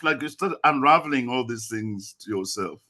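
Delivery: ordinary talk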